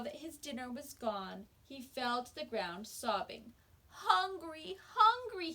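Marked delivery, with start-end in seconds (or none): 3.9-5.5 a high, pitiful hungry whine, louder the second time; whimpering dragon. Acted. The sobbing dragon begs the sisters, whining high. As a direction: plead like a starving beast. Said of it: "he whimpered".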